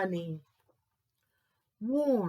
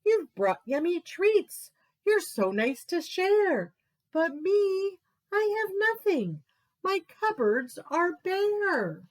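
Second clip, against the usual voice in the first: moaning Bear; 9 semitones higher, 4 dB louder than usual. Acted. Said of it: "Bear sighs and he moans".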